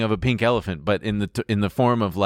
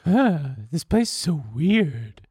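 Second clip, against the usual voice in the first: high, slow mock date voice; imitating weird date; 6 semitones higher, slower than usual. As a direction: mimic an oddball date, high and drawn out. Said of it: "this exactly how she sounds".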